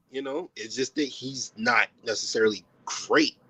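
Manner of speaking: ordinary delivery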